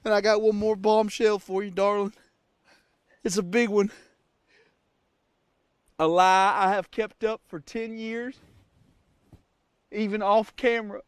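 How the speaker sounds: put-on cowboy accent. Apologizing to fans and Amanda, in fake Texan accent. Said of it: "I don't even have an accent".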